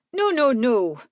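high, loud, hurried-to-reassure denial; insistent, alarmed protest; urgent protest. A narrator's reading. Calming a man who screamed.

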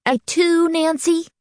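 high, theatrically wounded; hammed-up hurt question; mock dismay. A narrator's reading. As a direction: play at being betrayed, pitched high. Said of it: "in mock dismay".

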